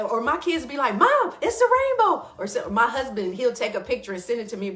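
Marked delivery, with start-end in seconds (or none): 1.0-2.3 put-on much higher, louder child's cry; a child calling to mom, excited. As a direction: squeal it out like a thrilled kid. Kids spotting a rainbow.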